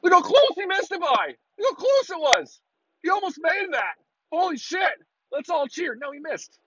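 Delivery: high, hammed-up fan voice cheering a miss; mock an overexcited crowd. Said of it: "they go nuts still".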